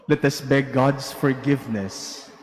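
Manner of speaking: usual speaking voice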